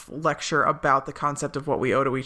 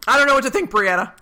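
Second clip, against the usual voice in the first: exasperated exclaim; 8 semitones higher, 5 dB louder, faster than usual. Real, not acted. Mock despair over fan theories.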